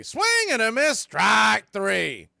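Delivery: high, loud rising call of the strikeout; excited strikeout call. Real, not acted. Radio announcer calling a strikeout for fans, excited and loud. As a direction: sell the big strikeout to the listeners.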